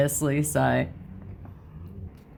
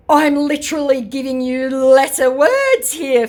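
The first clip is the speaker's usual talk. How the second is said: high, loud, slow sneer, dropping on fool; smug scammer voice; 10 semitones higher, 6 dB louder, slower than usual. Retold or imitated. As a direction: talk down to him like a smug scammer. Reading out the scammer's reply.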